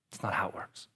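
low hushed whisper; soft conspiratorial aside. Real, not acted. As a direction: drop to a secret whisper. Hushed correction.

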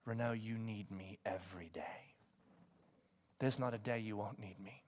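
low, hushed, tender reassurance; quiet, low, intimate counsel; Jesus. Retold or imitated. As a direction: speak softly and gently, as if consoling. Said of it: "Jesus says to me".

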